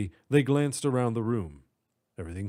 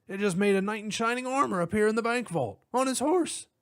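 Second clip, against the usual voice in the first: excited high cop voice; 10 semitones higher than usual. Acted.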